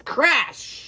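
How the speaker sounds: sudden dramatic crash. Acted. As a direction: bang it out.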